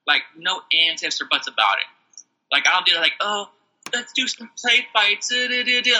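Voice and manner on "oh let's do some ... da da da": high singsong parody; mocking put-on voice. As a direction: make play-fighting sound silly. Mocks play fighting.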